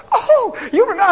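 high, loud retold outburst; hammed-up astonished cry; excited exclamation. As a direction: burst out in amazed delight. Retells his startled delighted reaction.

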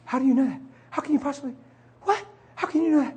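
high, astonished wife voice; amazed wife. Retold or imitated. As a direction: ask it high and stunned. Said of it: "she said".

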